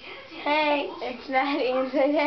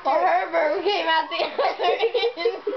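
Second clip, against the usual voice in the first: giggling through the words; cracking up mid-sentence; laughing; 5 semitones higher than usual. Real, not acted. Laughing about burp joke.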